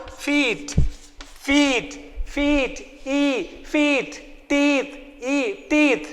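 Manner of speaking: feet and teeth with a long ee, soft; long e sound. Shown as a demonstration. Teacher drilling the long e softly for pupils. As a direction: stretch the ee in feet. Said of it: "E will sound like E".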